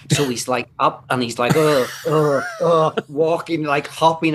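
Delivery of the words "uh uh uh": grunting struggle. Retold or imitated. Hopping on a dead leg.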